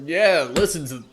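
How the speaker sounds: a lightly voiced half orc teasing his friend; gruff half orc. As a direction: cheer the woman on, loudly. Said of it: "the half orc is like".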